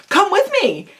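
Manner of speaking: high-pitched, upbeat urging; direct friendly invitation. Shown as a demonstration. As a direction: urge a friend along, simple and direct. Acted example invitation, same as before.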